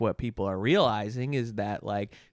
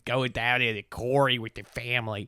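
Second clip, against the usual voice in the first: mock Delco accent. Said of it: "my culture is not your costume".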